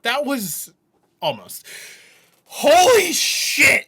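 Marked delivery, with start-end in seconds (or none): 2.4-3.9 very high, loud, slow exclamation; real elated yell; amazed shout. Real, not acted. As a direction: shout it out, floored. Reacting to surprise verse.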